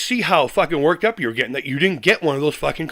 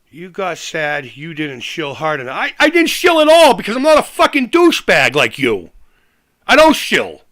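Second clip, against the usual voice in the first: angry outburst; 4 dB louder than usual. Real, not acted. Calling out a rival YouTuber, furious shout. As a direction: build from a sneer into a shout.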